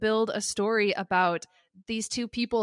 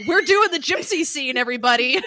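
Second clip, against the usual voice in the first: louder, higher excited announcement; loud announcing; 4 semitones higher, 8 dB louder than usual. Retold or imitated. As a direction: call it out to the room. Producers announcing loudly.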